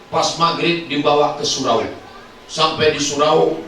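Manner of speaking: ordinary delivery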